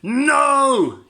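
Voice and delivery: huge, high, loud roar. A narrator's reading. Little Wolf finally loses his temper and roars. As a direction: explode with a roar. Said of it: "he roared".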